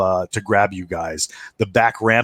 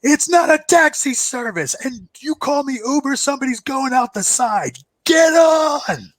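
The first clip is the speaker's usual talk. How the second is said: gruff crew chief. Acted. Crew chief shouting at the players to get on. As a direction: yell it over the rotor noise. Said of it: "and he's like".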